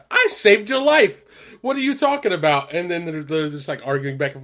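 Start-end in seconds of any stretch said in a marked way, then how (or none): 0.1-2.7 mock outraged protest. Retold argument, Frank protests.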